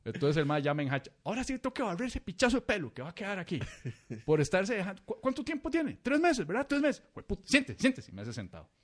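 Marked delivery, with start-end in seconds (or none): 1.2-6.0 scolding barber. Retold or imitated. 6.0-7.0 incredulous barber. Retold or imitated. Barber repeating, high. 7.1-8.1 bossy barber. Retold or imitated. Barber ordering him to sit.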